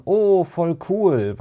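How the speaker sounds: high, drawn-out awestruck cheer; amazed call. A narrator's reading. Second-graders amazed by the homemade tree, an awestruck drawn-out call. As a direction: call it out slowly in wonder. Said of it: "staunten und riefen".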